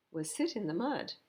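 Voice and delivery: ordinary delivery, nothing marked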